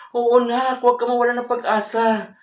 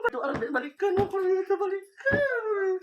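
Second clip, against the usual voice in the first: acted sobbing plea, much softer; crying; 7 semitones higher, 7 dB quieter than usual. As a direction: wail high, then sink into soft weeping. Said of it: "ba't ka umiiyak".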